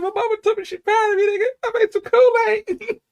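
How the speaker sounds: put-on high, slowed, bragging kid voice; giddy proud kid. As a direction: brag like a thrilled child. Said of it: "I was like".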